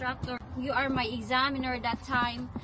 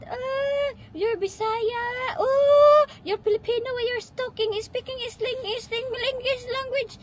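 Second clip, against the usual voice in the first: high-pitched, hammed-up jeering at a Bisaya; mock online commenter; 10 semitones higher, 4 dB louder than usual. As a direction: mimic commenters in a much higher, exaggerated voice. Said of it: "that will be the comment". (kunwaring mapanghusgang komentarista, mataas ang boses)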